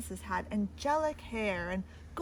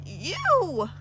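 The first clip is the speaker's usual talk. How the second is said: loud, higher croak; croaking princess; 5 semitones higher, 5 dB louder than usual. Acted. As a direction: bark it like a frog princess. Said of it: "croaked Princess Isabella".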